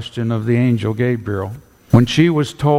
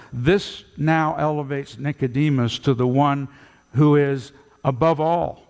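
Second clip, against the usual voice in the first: ordinary talk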